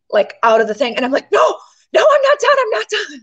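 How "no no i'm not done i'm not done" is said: high, urgent retold call after someone leaving. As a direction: call her back before she leaves. Calling after the leaving girl.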